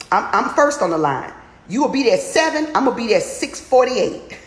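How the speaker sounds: excited boast. Real, not acted. Excited boast about being first.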